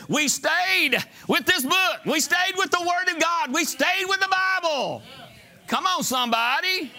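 high, insistent, drawn-out declarations; rally the congregation with each repeated phrase; impassioned preaching climax. Real, not acted.

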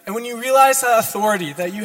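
loud, fervent preaching; preaching at full force. Acted. Sermon builds to full force.

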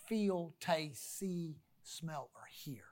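trailing off into a hush; fading to near silence. Real, not acted. Trailing list.

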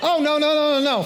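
high, loud protest to the crowd; emphatic no. Real, not acted. Preacher to congregation after the joke, loud protest. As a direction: snap the congregation back from laughing.

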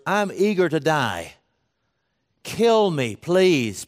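desperate plea. Retold or imitated. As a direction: Jonah crying out to be thrown overboard. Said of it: "In other words".

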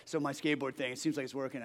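put-on young pro skater telling his pastor news, low and relaxed; deep laid-back voice. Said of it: "He's like".

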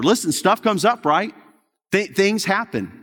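ordinary talk

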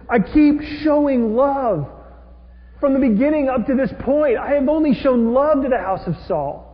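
aggrieved. Retold or imitated. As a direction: insist on your loyalty, wounded and loud. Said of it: "he feels unappreciated and underestimated".

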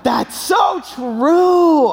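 high, drawn-out awed agreement; slow realization. Retold or imitated. Walking out of the wedding, slow and awed. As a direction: let it land slowly. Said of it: "walked out being like".

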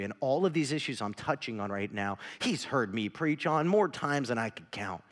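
usual speaking voice

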